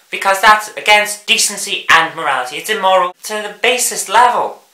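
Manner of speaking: ordinary talk, nothing marked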